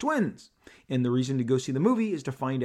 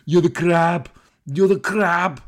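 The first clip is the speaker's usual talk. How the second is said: loud, hammed-up action-star impersonation; big booming mock taunt; Arnold Schwarzenegger; 5 semitones higher, 9 dB louder, slower than usual. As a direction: punch it out loud in a put-on Austrian accent. Acting out Schwarzenegger's taunting reply.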